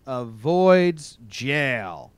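slow, drawn-out newscaster delivery; news headline read. Acted. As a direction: announce the headline slowly and emphatically.

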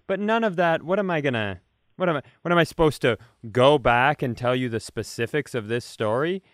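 normal talking voice

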